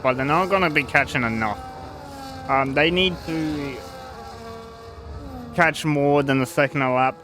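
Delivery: normal talking voice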